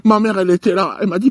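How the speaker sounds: normal talking voice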